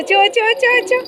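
high rapid-fire pet calls; excited urging. Real, not acted. Owner egging on the running dog, excited high calls. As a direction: squeal little calls to spur a dog on.

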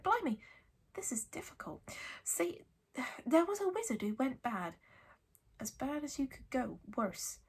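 hushed, halting tale-teller; Hagrid, nervous. A narrator's reading. Hagrid struggling to name the bad wizard, nervous. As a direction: struggle to get it out.

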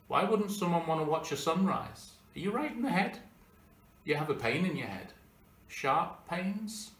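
mock disbelief. Speaker scoffs at a companion refusing the sunrise, mock disbelief.

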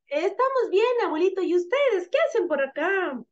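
put-on high-pitched girl puppet; little girl. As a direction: answer grandpa as a little girl. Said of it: "la niña dice". (voz aguda de niña)

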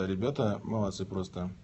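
usual speaking voice

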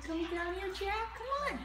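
high calls to a cat; calling a cat down from above, high and coaxing. Real, not acted.

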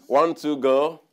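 loud count to start everyone; count-in call. Real, not acted. Preacher counts the congregation in, loud. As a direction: cue the crowd like a conductor.

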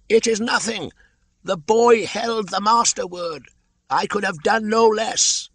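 very high, loud bird cry from above; a shrill-pitched kite shouting down to the bear; Chil the kite calling. Acted. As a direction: call down from the sky like a kite.

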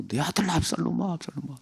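high wail fading to a breath; a hint of sobbing out the name; mock wailing. Preacher imitating David weeping for Absalom, mild wail. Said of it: "그냥 펑펑 우는 거예요".

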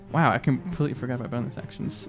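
ordinary talk, nothing marked

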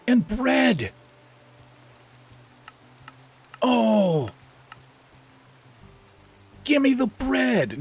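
very high, squealy voice of cookie glee; hammed-up, high-pitched delight; overexcited. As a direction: squeal like an overjoyed little cookie fiend. Mock glee at finding cookie recipe.

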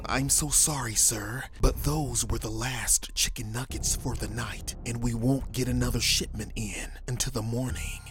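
usual speaking voice

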